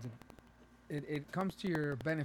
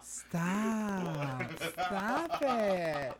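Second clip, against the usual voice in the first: drawn-out, coy protest; mock swooning; 3 semitones higher, 3 dB louder, much slower than usual. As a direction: stretch it out teasingly, pitch lifting. Teasing swoon over deep voice.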